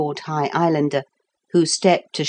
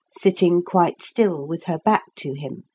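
plain reading voice

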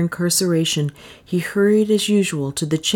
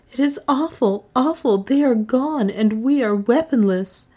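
higher, dismayed voice; distressed priest; 8 semitones higher than usual. A narrator's reading. Francisco despairs at being left weaponless, distressed. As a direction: lament it in a raised pitch.

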